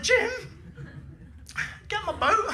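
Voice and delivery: high panicked squeal to a mate; panicked plea. Retold or imitated. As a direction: call for help in a high panic. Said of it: "I was like".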